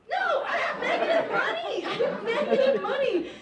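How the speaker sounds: high, loud, laughing protest. Real, not acted.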